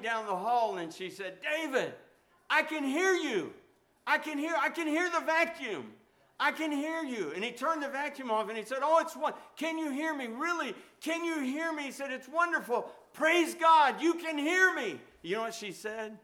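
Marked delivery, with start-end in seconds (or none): 1.4-7.3 much higher, louder cry of amazement; excited wife. Retold or imitated. Deaf wife suddenly hears. 8.8-15.0 high, loud, thrilled questions; overjoyed, praising God out loud; amazed husband. Retold or imitated. Husband amazed wife can hear.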